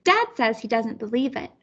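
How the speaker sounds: plain reading voice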